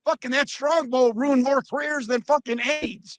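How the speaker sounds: Honky Tonk Man. Retold or imitated. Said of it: "you can just hear Honky Tonk Man, the voiceover".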